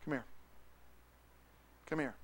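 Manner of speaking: soft gentle call; quiet tender invitation; Jesus calling. Acted. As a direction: call him over tenderly. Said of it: "turns and says".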